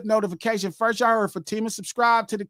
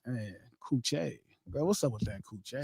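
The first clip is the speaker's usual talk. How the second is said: low, much softer mock come-on; seductive mock voice; 8 semitones lower, 14 dB quieter than usual. Joking host playing a smooth talker, low and soft. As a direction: lower the voice like a lazy pickup line. Joking about slow sex.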